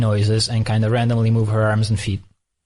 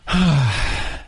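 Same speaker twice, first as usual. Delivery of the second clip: imitated baby sigh. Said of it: "and then she goes".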